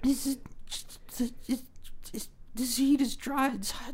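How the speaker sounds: dazed whisper. Acted. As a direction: barely breathe it out, stunned. Dazed after shooting.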